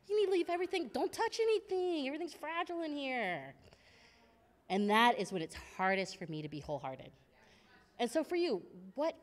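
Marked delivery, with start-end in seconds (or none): 0.1-3.5 prissy high warning. Retold or imitated. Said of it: "It's like".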